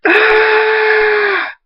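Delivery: very high, sustained shriek; long high scream of pain. A narrator's reading. Thunderstorm clawed and bitten, screaming at length. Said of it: "Thunderstorm screams".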